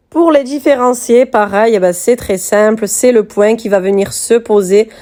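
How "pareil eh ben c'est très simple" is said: normal talking voice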